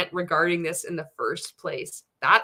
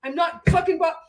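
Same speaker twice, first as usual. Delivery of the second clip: higher, louder mock denial as Bob; 9 semitones higher, 5 dB louder than usual. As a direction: play Bob insisting he's not bothered. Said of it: "He's like".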